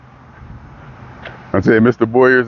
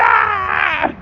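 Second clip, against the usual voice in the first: surprised shout. Retold or imitated. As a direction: yelp out in delighted shock. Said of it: "I went".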